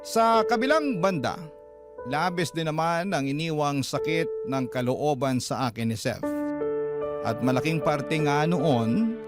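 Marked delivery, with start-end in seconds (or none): none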